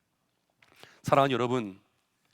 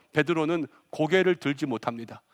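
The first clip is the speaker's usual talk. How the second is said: normal talking voice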